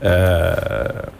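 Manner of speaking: ordinary talk, nothing marked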